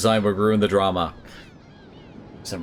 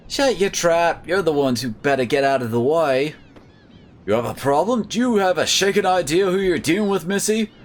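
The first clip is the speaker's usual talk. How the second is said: feisty mock-Aussie heroine voice; terrible Australian accent; 5 semitones higher than usual. Heroine telling villains to back off, bad Australian accent. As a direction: talk back in a badly faked Australian accent. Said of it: "as I do my terrible Australian accent here".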